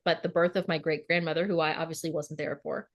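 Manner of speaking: normal talking voice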